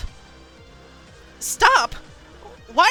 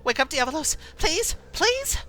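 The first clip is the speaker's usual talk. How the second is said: high pleading, climbing with each cry; frantic entreaty, pitched up; desperate pleading; 12 semitones higher than usual. Acted. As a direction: beg with rising panic. Rider begging entranced horse.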